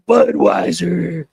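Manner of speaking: a trashy inner voice bursts out. Acted. Voice in back of head.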